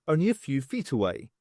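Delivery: ordinary delivery, nothing marked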